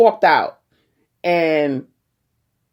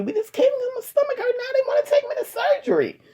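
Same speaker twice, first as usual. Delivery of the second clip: squeaky disbelief. Retold or imitated. Retelling disbelief to daughter's father.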